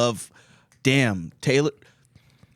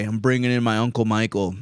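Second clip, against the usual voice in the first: normal talking voice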